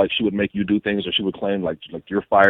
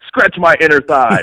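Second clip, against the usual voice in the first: high, loud and slow; mock diva demand; 6 semitones higher, 8 dB louder, slower than usual. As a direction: command it like a pampered diva.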